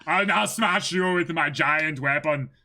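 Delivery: hammed-up Schwarzenegger voice; bad Schwarzenegger impression. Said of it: "really bad Arnold Schwarzenegger impression".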